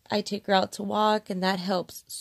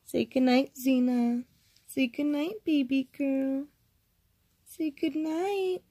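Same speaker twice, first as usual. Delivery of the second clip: sleepy talk to pet; 5 semitones higher than usual. Real, not acted. Coaxing dog to say goodnight.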